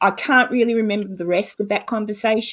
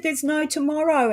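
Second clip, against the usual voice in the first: higher-pitched hopeless lament; retold despair; 7 semitones higher than usual. Repeating her dread to her partner, retold despair. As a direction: relive utter hopelessness. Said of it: "absolute despair and depression".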